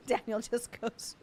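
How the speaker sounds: ordinary talk, nothing marked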